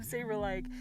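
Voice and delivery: normal talking voice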